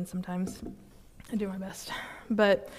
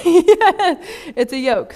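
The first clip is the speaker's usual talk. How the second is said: higher, louder, slow, laughing through it; laughing repeat; 8 semitones higher, 10 dB louder, much slower than usual. Real, not acted. Repeating the answer to the group, laughing. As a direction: laugh as you say It's a yoke.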